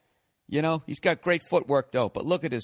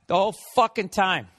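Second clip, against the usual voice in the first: normal talking voice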